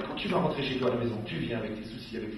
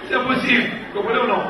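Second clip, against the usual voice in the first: higher, louder insistent plea; mock exasperation; 8 semitones higher, 8 dB louder, faster than usual. Wife insisting to the rabbi, loud and exasperated. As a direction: press the rabbi to agree.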